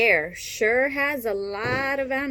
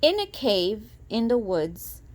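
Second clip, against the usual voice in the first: plain reading voice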